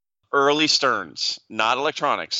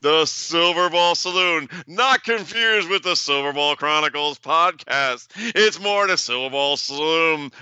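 ad read; 4 semitones higher, slower than usual. Acted. Plugging his bar, ad-like.

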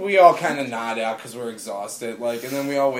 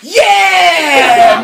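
long, high, roaring cheer; crowd cheer; 15 dB louder than usual. Retold or imitated. Everybody on the plane reacting to the news, cheering yell. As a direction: holler like a whole plane celebrating. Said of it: "everybody on the plane just goes".

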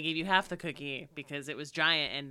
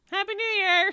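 loud, high mock cheer; excited cheer; 13 semitones higher, 10 dB louder than usual. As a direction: sing out a mock celebration. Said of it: "I'm like".

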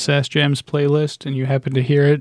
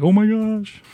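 mock excitement; 7 semitones higher, slower than usual. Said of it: "I'd be like".

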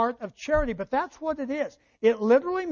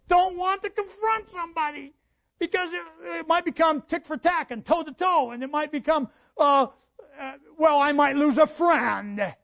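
higher, whiny imitation of a worrier; mock reluctant whining; 5 semitones higher than usual. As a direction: fret like someone dreading confrontation. Voicing people who shy from confrontation.

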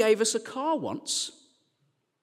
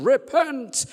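high, raised cry of a street preacher; mock preaching shout; 6 semitones higher, 4 dB louder than usual. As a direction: call it out like a city-centre preacher. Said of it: "in the city centre shouting".

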